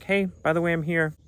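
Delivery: a hint of a gentle greeting; lightly voiced Zeus. To the narrator at night, gentle hint of Zeus. Voicing Zeus's gentle message.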